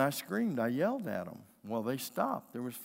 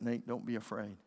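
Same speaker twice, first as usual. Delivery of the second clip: put-on lower, softer word to a child; calm reassurance; 4 semitones lower, 6 dB quieter than usual. Dad to his son after the danger, low calm reassurance. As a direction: settle it down, low and soft.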